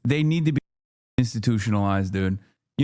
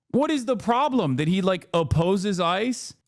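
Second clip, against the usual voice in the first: ordinary talk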